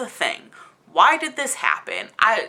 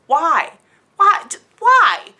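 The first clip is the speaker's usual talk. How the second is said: rising, louder, ever more incredulous whys; exasperated why; 6 semitones higher, 6 dB louder, slower than usual. Real, not acted. Reviewer venting at the book's twist, exasperated and loud. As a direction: throw up your hands at the plot.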